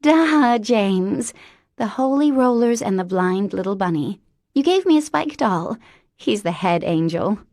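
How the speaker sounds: put-on high, bright-eyed childlike voice; an eager little girl in a grown woman; childlike Mary Elizabeth.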